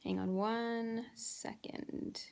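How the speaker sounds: slow, breathy whisper aside; whispered aside to the viewers. Real, not acted. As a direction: drop to a whisper while checking notes. Pauses to check her notes.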